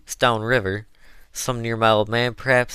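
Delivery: "perhaps" held up high as a tentative question. A narrator's reading. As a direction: float "perhaps" upward, half asking. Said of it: "speaking last in a tone of a question".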